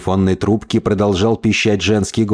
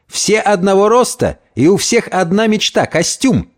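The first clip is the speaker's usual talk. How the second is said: high-pitched, enthusiastic pitch to pals; animated schemer, voice raised in pitch; excited Gomez; 9 semitones higher than usual. Acted. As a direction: sell them the shared dream.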